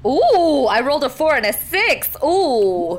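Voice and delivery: high, loud delighted oohing; excited. Real, not acted. Player reacting to a great dice roll, excited. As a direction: crow over a lucky roll.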